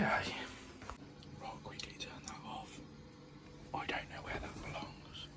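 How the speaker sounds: panicked whispered swearing; whispering. Real, not acted. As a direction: hiss it under your breath. Panicked fixing the water.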